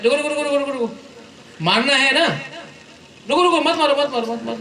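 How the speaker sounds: high, urgent shouts of stop; urgent calls to stop. Real, not acted. Speaker calling to students hitting a boy, high urgent shouts. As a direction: call out over the crowd to halt the beating.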